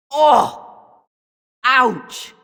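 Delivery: sharp high yelp of pain; sudden hurt yelp; pained cry. Acted. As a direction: cry out as the hit lands. Hit in a duel.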